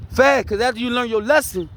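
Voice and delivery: ordinary talk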